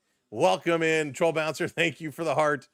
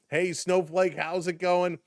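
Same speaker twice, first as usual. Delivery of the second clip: ordinary talk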